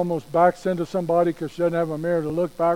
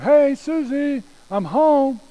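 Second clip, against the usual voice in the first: high, loud, drawn-out holler; yelling upstairs; 10 semitones higher, 6 dB louder, much slower than usual. Acted. Dad yelling up to Susie that he's home, long holler. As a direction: holler up the stairs, stretching the words. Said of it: "So Dad yells up and says".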